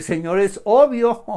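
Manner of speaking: loud, high, stating the obvious; incredulous protest. Acted. Blind men answering Jesus's question, incredulous. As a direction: exclaim it as if it could not be clearer. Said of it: "Ellos podrían decir".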